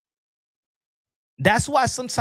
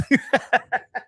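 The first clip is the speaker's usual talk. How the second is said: high run of chuckles; real giggly laugh; amused laugh; 5 semitones higher than usual. Real, not acted. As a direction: laugh at your own naughty joke. Laughs after apologising for joke.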